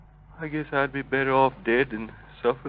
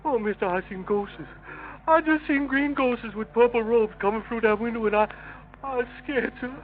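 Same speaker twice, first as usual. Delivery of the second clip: high, scared, begging voice; frightened pleading; 7 semitones higher than usual. Acted. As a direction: plead like he saw ghosts. Prisoner claims seeing ghosts.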